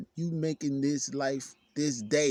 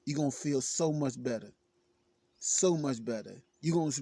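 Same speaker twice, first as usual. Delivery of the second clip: ordinary talk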